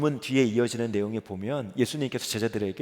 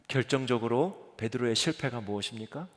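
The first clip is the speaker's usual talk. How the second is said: ordinary delivery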